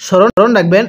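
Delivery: ordinary talk, nothing marked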